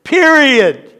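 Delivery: one-word emphatic verdict; emphatic full stop. Shown as a demonstration. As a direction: land hard on "Period", as final. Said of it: "Put a period right there".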